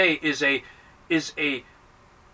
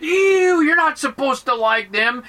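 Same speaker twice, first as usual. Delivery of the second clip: high, loud "ew" easing into a scolding; hammed-up disgust; disgusted old self; 6 semitones higher, 4 dB louder than usual. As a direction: recoil in mock disgust, then chide. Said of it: "our old self goes".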